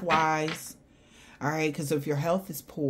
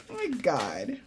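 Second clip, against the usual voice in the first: ordinary talk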